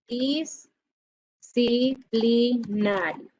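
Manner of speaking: Disciplinario stretched out very slowly, syllable by syllable. Shown as a demonstration. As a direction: drag out Disciplinario as if counting its letters. Teacher slowly spells out a word. (Disciplinario muy lento, sílaba por sílaba)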